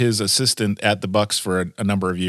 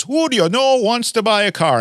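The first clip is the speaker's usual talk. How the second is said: much higher, brash car-dealer voice; mock salesman pitch; imitating Ernie; 13 semitones higher than usual. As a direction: play the pushy car salesman on the phone. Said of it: "Ernie calls and goes".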